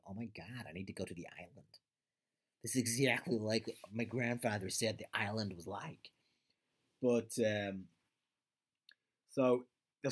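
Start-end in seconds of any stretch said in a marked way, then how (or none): 0.1-6.1 lightly voiced gushing American accent; a hint of an awestruck American tourist; mock American tourist. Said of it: "Americans are gonna watch it now and go".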